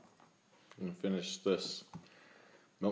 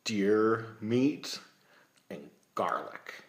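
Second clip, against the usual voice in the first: disgusted; 5 semitones higher, 11 dB louder, much slower than usual. Real, not acted. Disgust at garlic and deer meat.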